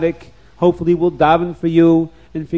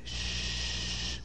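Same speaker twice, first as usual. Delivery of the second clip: breathy hush for silence; shush; 17 dB quieter than usual. Acted. Maharal hushing the frightened parents, soft shh. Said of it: "And he said".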